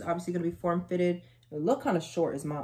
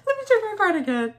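much higher, louder, laughing through the words; 8 dB louder than usual. Real, not acted. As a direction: laugh in disbelief at herself. Laughing at a double order.

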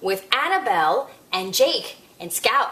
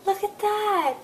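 amazed gasp; 7 semitones higher than usual. Pretend. Puppet gasps in delight.

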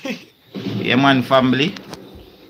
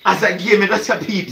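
laughing repeat; 8 semitones higher than usual. Real, not acted. Mocking friend's phrase, laughing.

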